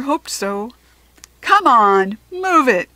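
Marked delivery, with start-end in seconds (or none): 1.4-2.9 loud, high impatient yell; shouting. A narrator's reading. Sixth grader yelling at the lunch lady to hurry, loud. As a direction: bark it at the slow server. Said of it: "a sixth grader shouted".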